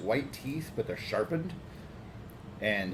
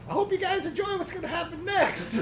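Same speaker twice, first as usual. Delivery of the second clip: high, menacing crone voice; evil old lady voice. Acted. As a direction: tease the watchers like a wicked hag. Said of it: "And she says".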